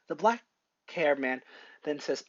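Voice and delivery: ordinary delivery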